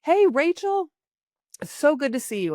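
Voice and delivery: high on hey, then settling; upbeat hello using her name; eager greeting. Shown as a demonstration. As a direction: open high and friendly, ease down. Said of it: "use their name".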